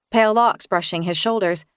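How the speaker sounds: ordinary delivery, nothing marked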